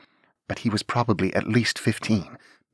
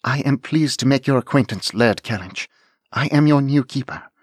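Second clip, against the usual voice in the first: higher, louder formal greeting; formal young lady; 6 semitones higher, 5 dB louder than usual. Acted. Anne formally greeting the dragon Laird Kellynch.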